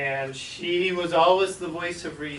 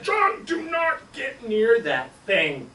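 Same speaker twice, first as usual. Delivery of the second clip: slow, louder, weighted warning; stern order; 8 semitones higher, 6 dB louder, much slower than usual. Retold or imitated. Debbie sternly warning little John off the trap. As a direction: lay down the law.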